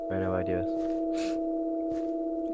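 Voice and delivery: high sing-song. Pretend.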